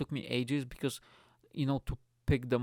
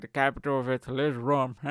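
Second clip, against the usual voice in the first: louder put-on robotic answer; assistant voice; 5 dB louder than usual. Smart speaker answering geography question, robotic impression. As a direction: imitate a device announcing a fact. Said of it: "it's gonna be like".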